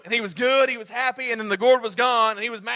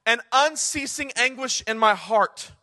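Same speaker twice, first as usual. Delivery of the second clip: slow, weighty scripture read; grave reading of Paul's lament; slow solemn reading; 3 dB louder, slower than usual. Shown as a demonstration. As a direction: let each word sink in. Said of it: "He says".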